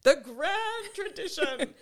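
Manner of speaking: loud, high, slow grand proclamation; mock opera. Imitating the co-host's sweeping line, mock operatic. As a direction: draw it out like an opera diva. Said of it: "like she was in an opera".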